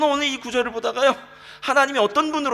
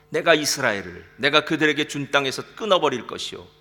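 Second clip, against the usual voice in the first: scripture reading; 8 semitones lower than usual. Acted. Reads scripture passage aloud.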